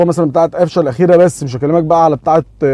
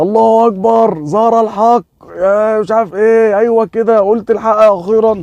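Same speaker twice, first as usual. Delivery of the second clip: high, drawn-out mock-triumphant cry; imitating a friend's jubilant outburst; triumphant gloating; 6 semitones higher, slower than usual. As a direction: proclaim it like a long-awaited victory, stretching the words. Friend gloats triumphantly at perceived admission.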